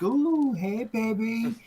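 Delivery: flirty women.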